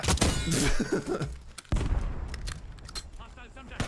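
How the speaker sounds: long breathy laugh; breathy, trailing chuckle; laughter. Real, not acted. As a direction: laugh it off after a fright. Laughs after own yelp.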